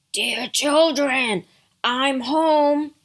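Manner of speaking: loud, gruff wolf calling out; big bad wolf posing as mother; deep gruff wolf. A narrator's reading. Wolf calls to the kids at the door, deep and gruff. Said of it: "You have a deep, gruff voice".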